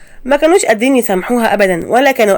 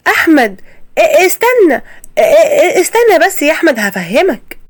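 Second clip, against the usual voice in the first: high, stammering plea to her son; mother caught plotting begs her son to wait, stammering; panicked stammer; 7 semitones higher than usual. Acted. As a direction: caught out, stumble over the words, pitched up.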